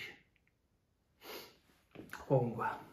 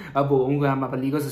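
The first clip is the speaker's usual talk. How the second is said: raised voice; 11 dB louder than usual. Acted. Heated quarrel between brothers.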